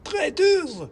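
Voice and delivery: extremely high, loud squeaky shout; a tiny fighter screaming; shrieking mouse. Acted. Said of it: "came another".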